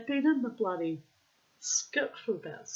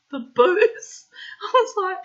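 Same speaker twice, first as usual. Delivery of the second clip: laughing; 11 semitones higher, 8 dB louder than usual. Real, not acted. Homeless man's confession retold.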